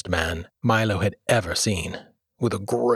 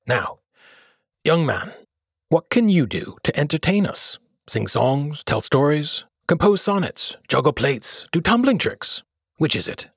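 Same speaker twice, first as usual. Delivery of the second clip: king voice; 6 semitones higher than usual. A narrator's reading. King questions Milo.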